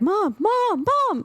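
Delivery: put-on a kid urgently trying to wake his mom; urgent son calling. Son waking screaming mom, high urgent calls. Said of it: "Ryan's like".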